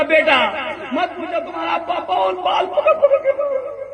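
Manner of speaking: high, tearful wail through laughter; hammed-up sobbing complaint; mock crying. Mother complaining to her son, mock tearful wail. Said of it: "और रोना शुरू कर दी".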